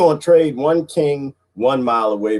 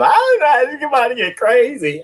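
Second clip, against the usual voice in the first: higher worried voice quoting a friend; mock worried friend; 13 semitones higher than usual. Quoting a scared friend in a higher voice. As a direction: play the panicky friend, pitched up.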